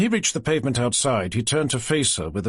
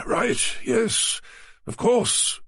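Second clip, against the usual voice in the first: breathy, higher flustered reply; 12 semitones higher, much slower than usual. A narrator's reading. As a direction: caught out and rushing to agree. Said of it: "came Naylor's flustered response".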